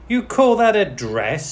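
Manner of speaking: high, loud mocking scream. A narrator's reading. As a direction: shriek it in scorn. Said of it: "screamed the other".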